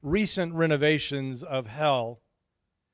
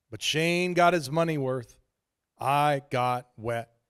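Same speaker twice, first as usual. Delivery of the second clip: usual speaking voice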